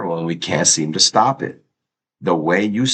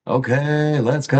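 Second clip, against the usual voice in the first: hype call; 6 semitones higher than usual. Real, not acted. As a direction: kick it off like a hype man. Stream hype catchphrase.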